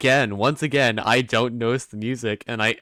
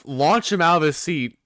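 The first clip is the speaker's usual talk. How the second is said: slow, louder mock proclamation; mock announcement; 5 semitones higher, 6 dB louder than usual. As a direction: announce it like a grand plan. Mocking the writers' plan.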